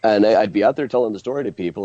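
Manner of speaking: ordinary delivery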